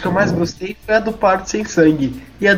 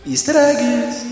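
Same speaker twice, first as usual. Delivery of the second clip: slow, higher spooky announcement; spooky echo; 5 semitones higher, much slower than usual. Pretend. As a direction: drag it out eerily.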